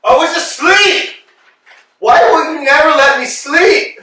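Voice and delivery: high, loud, aggrieved complaint; indignant protest. Acted. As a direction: complain loudly at being woken. Woken roughly, protesting.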